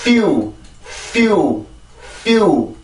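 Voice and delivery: "few" begun with plenty of air on the F; airy F. Shown as a demonstration. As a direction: push pure air through F before the vowel of "few". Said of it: "F에서 나오는 순수한 공기가 많이 나오고".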